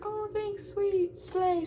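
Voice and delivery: lightly voiced chirpy Audrey; friend's voice. Audrey replying to her offer, in a high chirpy voice. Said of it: "She's like".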